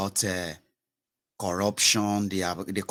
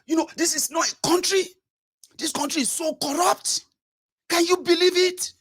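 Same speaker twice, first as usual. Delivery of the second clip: much higher, louder ranting merchant; outraged businessman; 4 dB louder than usual. Retold or imitated. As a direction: rant high-pitched at the reporter. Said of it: "He collected the microphone, say".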